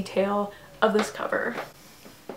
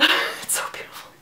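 breathless, fast awed whisper with a laugh; 4 dB quieter, much faster than usual. Retold or imitated. Opening the book package, gasping in an awed whisper. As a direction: whisper it like you just opened the package. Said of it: "I was just like".

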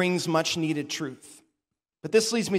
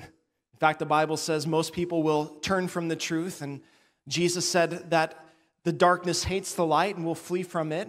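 ordinary talk, nothing marked

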